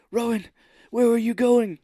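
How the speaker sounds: high, loud anxious calling after her; hammed-up frantic calling. Cage chasing Rowan, calling out in high loud worry. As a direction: call after her in hammy panic. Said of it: "and he's like".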